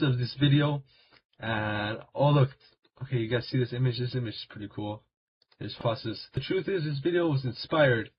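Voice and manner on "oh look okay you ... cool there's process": ordinary delivery, nothing marked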